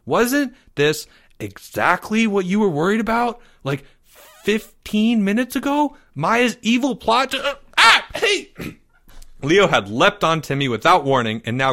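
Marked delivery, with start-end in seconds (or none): 0.1-7.4 put-on high kid voice; insistent young boy. A narrator's reading. Boy trying one last warning, high and insistent. Timmy reasons with Leo. 7.4-8.5 high, choppy cries; startled yelps. A narrator's reading. Boy jumped on by his friend, startled squawks.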